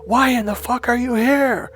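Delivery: high, pushing each word hard; retold shouting. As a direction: blast the contradiction at him. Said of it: "here's what I said".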